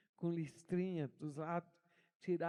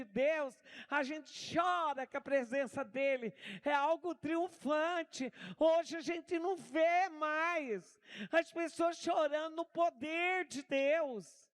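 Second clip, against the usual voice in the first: slow, raised, fervent preacher voice; lifting the church up, slow and loud; fervent preaching; 6 semitones higher, 4 dB louder than usual. Acted. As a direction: preach it out, drawing each word. Preaching at full force.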